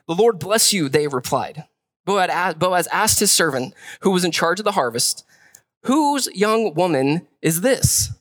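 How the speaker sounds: measured reading of the passage; scripture reading. Acted. Preacher reading Ruth to the church, measured scripture voice. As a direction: read it out to the congregation.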